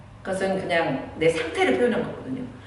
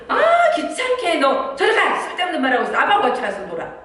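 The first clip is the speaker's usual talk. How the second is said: annoyed mother; 10 semitones higher, 5 dB louder than usual. Retold or imitated. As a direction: shoo the child away, hammed-up irritation. Mother brushes off child. (귀찮아하며 쫓아내는 엄마, 과장된 짜증)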